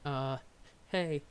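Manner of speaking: usual speaking voice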